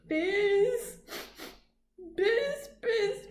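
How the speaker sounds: high, wailing bee buzz; sobbing buzz. Acted. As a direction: buzz through tears. Crying bee buzzing.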